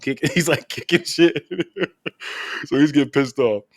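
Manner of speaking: words breaking into laughter; real laughter at the story; laughing. Real, not acted. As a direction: crack up as you say it. Laughing while retelling tantrum.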